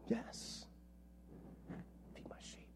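hushed whisper. Acted. Jesus and Peter's quiet answer, whispered. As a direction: drop to a whisper for the tender moment.

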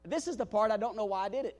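ordinary delivery

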